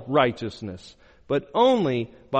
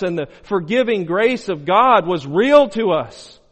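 loud, building preacher's cry; fervent preaching; 4 semitones higher, 6 dB louder than usual. Acted. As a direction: preach it out full force to the congregation. Preaching at full force.

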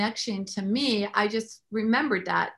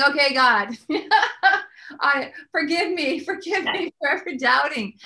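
higher and louder, laughing through a sheepish apology; laughing apology to God; 5 semitones higher, 5 dB louder than usual. Retold or imitated. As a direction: laugh it off while begging God's pardon. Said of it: "And I'm just going".

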